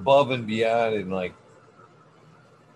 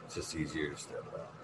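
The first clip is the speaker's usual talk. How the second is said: much softer, quicker; soft muttered repeat; 9 semitones lower, 16 dB quieter, faster than usual. Shown as a demonstration. Explaining to a friend why he steps out, muttered softly. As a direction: toss it off quietly and fast.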